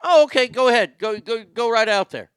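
a hint of a killer sending the housekeeper off; mock killer voice.

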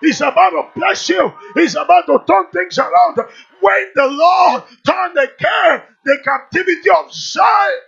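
loud, high, building proclamation with a long held last word; full-force preaching. Real, not acted. Preacher declaring blessings over the congregation, full-force shout.

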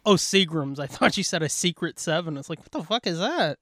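usual speaking voice

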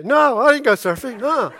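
high, loud, laughing fib; guilty laughing denial. Retold or imitated. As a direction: deny it badly, giggling.

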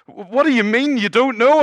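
high and loud, disbelieving; exasperated angel. Retold or imitated. Angel baffled by the thief's answer, loud and high. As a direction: demand an answer in a squeaky outrage.

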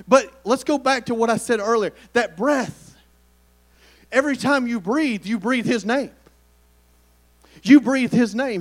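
ordinary talk

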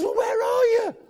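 high, loud shout into the dark; husband yelling into the night; calling out. Retold or imitated. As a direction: shout out to find him.

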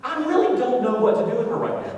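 high, loud, at wits' end; acted exasperation. Husband following dancing wife to the car, mock despair.

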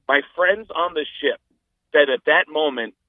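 ordinary delivery

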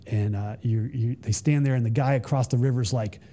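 ordinary delivery

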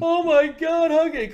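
high, loud horrified wail; mock horror. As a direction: cry out in hammed-up horror. Horrified kid in retold cartoon.

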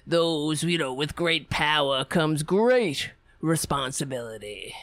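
low, pompous, self-important drone; mock blowhard congressman. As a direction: lecture like a puffed-up veteran politician.

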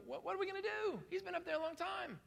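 high, anxious fretting voice; worried Israelites. Retold or imitated. Preacher voicing worried Israelites. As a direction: fret like a crowd left waiting.

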